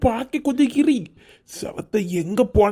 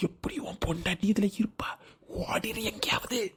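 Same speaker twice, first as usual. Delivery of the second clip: hushed urgent whisper; man secretly warning brother to run, whispering; 3 semitones lower, 11 dB quieter than usual. Acted. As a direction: whisper it so no one overhears. Secretly warns brother to flee.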